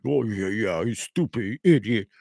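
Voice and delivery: drawn-out mock insult; sneering imitation of a rant. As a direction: imitate him ranting, dragging the words. Mocking quote of an angry man.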